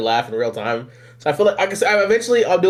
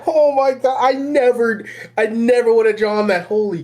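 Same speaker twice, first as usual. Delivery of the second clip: excited disbelief; 7 semitones higher than usual. Real, not acted. Still reacting to the joke.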